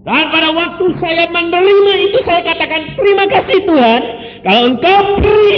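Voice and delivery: high, loud fervent preaching; full-throated sermon delivery; preaching at full force. Acted. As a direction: proclaim it to the whole church. Preacher raises voice to congregation.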